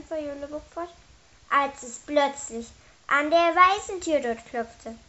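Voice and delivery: ordinary delivery, nothing marked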